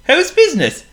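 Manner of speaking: high, loud squeaky penguin; a gloomy little penguin sighs. A narrator's reading. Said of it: "greeted Waldo Waddle".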